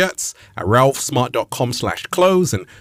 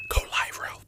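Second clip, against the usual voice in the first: soft unvoiced whisper; voicing the viewer's timid whispered request; 12 dB quieter than usual. Shown as a demonstration. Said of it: "Just say".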